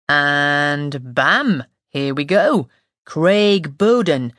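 put-on slow, broad northern English accent; thick northern accent, drawn out. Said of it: "a man with a thick northern accent".

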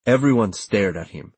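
plain reading voice

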